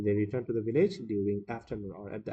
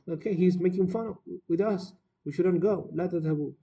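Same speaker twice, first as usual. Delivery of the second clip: insistent villagers; 7 semitones higher, faster than usual. Acted. Villagers refuse the shepherd's call.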